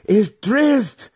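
very high, loud, strained cry; exhausted matron cries out; cried name. A narrator's reading. Matron Malice naming her newborn, high strained cry.

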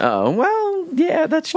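high, startled backpedal; caught out. Real, not acted. Cohost caught by a joke, high and startled. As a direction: squeak out an awkward concession.

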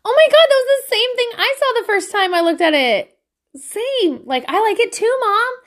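high-pitched imitation of an excited kid; sweet excited son. Son sharing a moment with mom over the same picture, sweetly excited. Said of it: "looked over so sweetly and was like".

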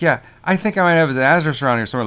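mock-sarcastic brush-off; super sarcastic. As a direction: toss it off like it's obvious nonsense. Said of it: "he says it super sarcastic".

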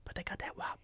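whisper. Real, not acted. Host whispers back a joking reply to his friend, hushed.

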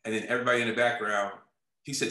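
ordinary talk

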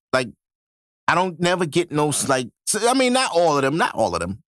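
ordinary talk

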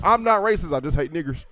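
hint of a drawling bigot, mocking.

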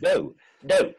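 high, loud exasperated refusal; Richard Wilson. Acted. Friend mimics the actor on holiday, exasperated impression. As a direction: refuse like an outraged grand actor. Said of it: "That's a marvellous impression of the".

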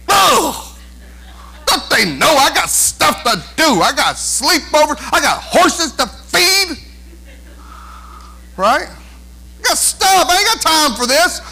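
put-on high, whining child's complaint; mock-crying student over homework; whiny kid. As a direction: whine like a put-upon kid.

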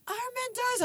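very high, thin mock yell; imitated shout. Sixth grader shouting a spoiler in the hallway, mock high yell. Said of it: "somebody shouted".

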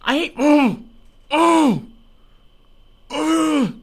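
high-pitched moaning whine; drawn-out high "oh" groans; frustrated whimper. Pretend. Groaning in frustration at failing show.

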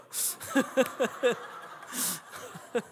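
long, soft, breathy chuckle; chuckling. Real, not acted. Speaker after his own punchline, chuckling. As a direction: laugh quietly at your own joke.

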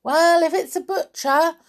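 high, loud yelled order; yelling. A narrator's reading. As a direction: yell an order to someone outside. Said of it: "yelled the voice".

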